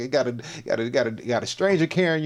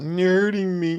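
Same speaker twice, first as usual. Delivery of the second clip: a lightly put-on whiny plea, imitating; mocking a crybaby's complaint; mock whiny victim; 6 semitones higher than usual. Mocks the arrested person's cries.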